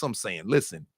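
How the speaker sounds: usual speaking voice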